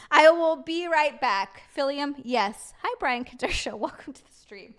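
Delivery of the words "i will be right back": slow and louder, every word stretched; playful slow declaration; mock grand announcement. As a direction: announce it like a big exit.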